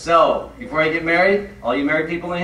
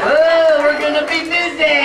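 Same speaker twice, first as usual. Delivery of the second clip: much higher, louder delighted cry; gleeful; 10 semitones higher, 5 dB louder than usual. Acted. Husband gloating to his wife over the calendar, gleeful.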